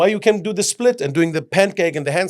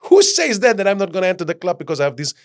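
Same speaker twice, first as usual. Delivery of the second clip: high, loud, quick protest; indignant retort; 5 semitones higher, 4 dB louder than usual. Retold or imitated. Retorting to the guys who doubt him, indignant and loud. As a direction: snap back at them, high and fast.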